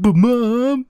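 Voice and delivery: put-on high-pitched pleading child; whiny protesting kid. Friend retelling a kid answering his mother, mock protest. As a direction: imitate a kid objecting to mom.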